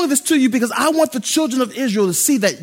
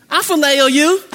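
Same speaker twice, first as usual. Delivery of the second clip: higher, louder confident reply; brash Peter; 6 semitones higher, 6 dB louder than usual. Acted. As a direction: Peter brushing it off, sure of himself. Peter's first boastful answer.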